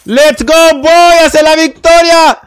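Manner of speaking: high, loud triumphant cheer; rally the squad like a war cry; hype battle cry. Real, not acted. Hyped battle cry in game.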